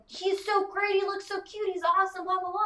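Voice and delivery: high-pitched imitation of a gushing customer; mock gushing. Voicing delighted customer picking up dog.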